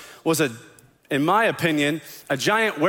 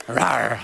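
lower, gruffer pretend roar; a deeper playful growl; dinosaur voice; 6 semitones lower than usual. Shown as a demonstration. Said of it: "What kind of sound would you make?".

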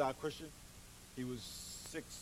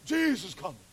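raspy Big Dog voice; 3 semitones higher, 9 dB louder than usual. Retold or imitated. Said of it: "he had a real raspy voice like that".

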